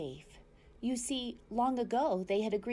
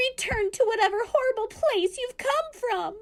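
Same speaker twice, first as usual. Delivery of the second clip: weasel squeak; 7 dB louder than usual. A narrator's reading. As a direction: squeak it out crouching scared. Weasel tells robot to leave.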